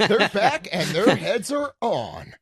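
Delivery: high, slow, drawn-out announcement; mock promo announcer. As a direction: announce it slowly like a TV teaser. Co-host redoes line as promo.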